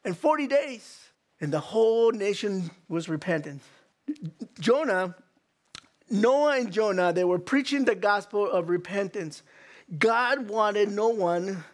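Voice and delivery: ordinary delivery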